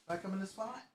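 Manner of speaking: high, soft cooing at the cat. Real, not acted. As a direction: coo her back to her spot. Baby talk to the cat.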